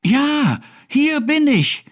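very high, eager kitten call; high squeaky answering call; little cat calling. A narrator's reading. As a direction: pipe up as a found kitten. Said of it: "ertönte es aus dem Gerümpel".

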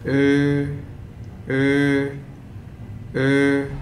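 ö with half-open, slightly rounded lips; German ö vowel. Shown as a demonstration. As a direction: hold ö, tongue mid, lips rounded. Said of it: "To pronounce this".

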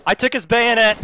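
triumphant high yell; excited shout. Real, not acted. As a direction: crow the victory. Triumph in fight.

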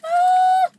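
high loud excited shriek; excited scream. Real, not acted. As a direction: squeal with delight. Seeing all the goodies.

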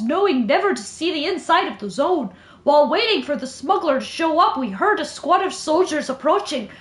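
careful attempt at British, leaning Irish; British-Irish accent. Shown as a demonstration. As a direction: read it in British drifting towards Irish. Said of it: "it's a little bit Irish".

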